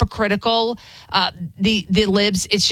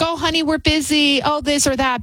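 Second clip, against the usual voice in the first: breezy excuse; 6 semitones higher than usual. Retold or imitated. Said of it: "I was like".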